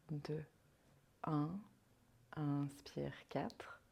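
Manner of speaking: calm, unhurried meditation voice with long pauses; guided meditation. Acted. Yoga guide leading a listener into rest and counted breathing, guided meditation. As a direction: guide them softly into rest, leaving space between phrases.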